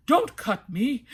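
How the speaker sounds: the branch begs; lightly voiced branch; talking branch. A narrator's reading.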